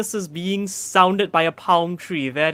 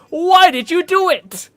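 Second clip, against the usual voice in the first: mock innocent Hawley; 7 semitones higher than usual. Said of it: "and he went".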